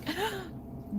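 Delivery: sharp high mock gasp of outrage; shocked gasp. Retelling her reaction to the comment, a high shocked gasp. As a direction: gasp like she just insulted you. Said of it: "and I was like".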